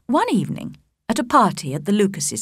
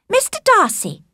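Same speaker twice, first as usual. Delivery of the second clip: very high, affected socialite; mocking Caroline; 4 dB louder than usual. A narrator's reading. Caroline Bingley coming up to Darcy, archly. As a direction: call to him in an arch, high voice.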